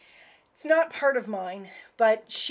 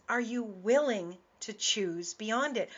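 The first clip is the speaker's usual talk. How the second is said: normal talking voice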